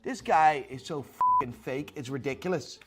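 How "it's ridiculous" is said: usual speaking voice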